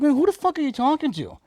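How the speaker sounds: high-pitched, acted-out comeback; indignant retort. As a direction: fire back in a retold quarrel. Acted quarrel with trucker.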